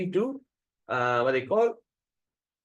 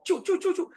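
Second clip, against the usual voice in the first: high sharp shooing sounds, much higher; shooing noises. Retold or imitated. The priest shoos the devotee off the path, high shooing calls. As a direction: shoo him off like a stray animal.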